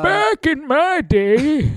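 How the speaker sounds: put-on high, slow cackling old-timer; creaky old geezer voice; old prospector.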